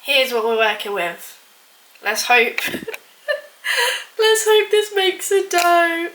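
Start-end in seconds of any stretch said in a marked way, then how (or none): 2.6-6.1 high, slow, laugh-choked plea; laughing doubtfully. Real, not acted. Baker doubting the gross mixture will work, laughing. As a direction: squeak it out through laughter.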